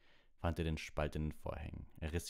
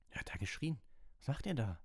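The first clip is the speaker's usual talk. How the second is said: seslenme; 9 semitones higher than usual. A narrator's reading. Sleepy voices calling after them.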